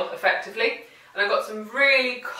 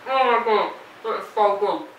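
talking through a bite; mouth full. Real, not acted. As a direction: say it while chewing. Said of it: "Don't talk with your mouth full".

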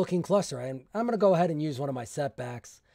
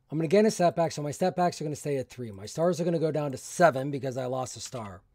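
normal talking voice

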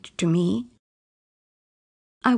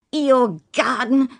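louder, higher, slow disbelieving repeat of "Your garden?"; scornful shout; 5 semitones higher, 3 dB louder than usual. Acted. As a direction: throw "Your garden?" back at her with contempt. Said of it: "my father shouted".

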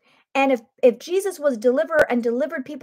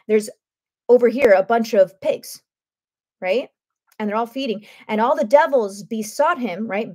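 ordinary talk

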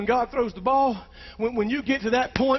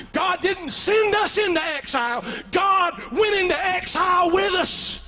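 preached shout; 6 semitones higher, slower than usual. Acted.

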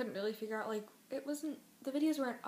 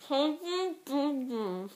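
very slow, garbled through full cheeks; cheeks stuffed full; 3 semitones higher, 4 dB louder, much slower than usual. Real, not acted. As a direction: barely get it out past the candy. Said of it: "Peeps are really hard to fit in your mouth".

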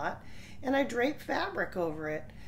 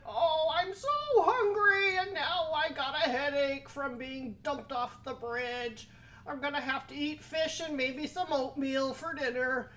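higher, self-pitying troll lament, slowing at woe; moaning troll; 7 semitones higher than usual. Acted. Troll bemoans his headache and hunger, whining. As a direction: whine it like a sorry troll.

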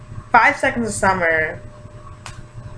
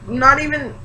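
ordinary delivery

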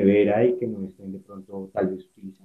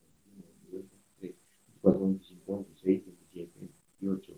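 counting under breath; 2 semitones lower, 6 dB quieter than usual. Real, not acted. Counting drops under her breath.

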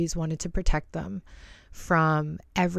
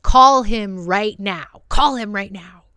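loud, forceful retold order; acted-out furious demand, slow and loud; imitated angry snapping; 3 semitones higher, 9 dB louder, slower than usual. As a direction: mimic him snapping, louder and higher, drawing out the command. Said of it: "was freaking snapping on me".

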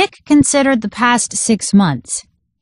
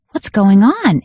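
ordinary delivery, nothing marked

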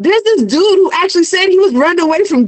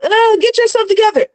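higher-pitched street preacher's warning, only half yelled; retold yell, raised in pitch more than volume; mock yelling; 8 semitones higher than usual. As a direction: imitate someone yelling a warning at a crowd. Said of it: "He's yelling, he's screaming".

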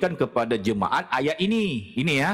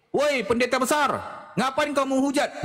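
mock yell; 9 semitones higher than usual. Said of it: "satu orang jemaat itu jerit".

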